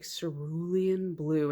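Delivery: slow, low murmur of a mind-controlling man; hint of a persuasive villain. Retelling an X-Files episode to viewers, a slow hint of the villain. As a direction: lightly voice the Pusher coaxing. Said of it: "and he's like".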